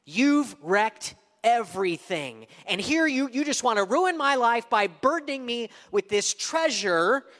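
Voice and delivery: bitter rising complaint; angry. Acted. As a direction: lash out in loud accusation. Servant voiced, blaming his master.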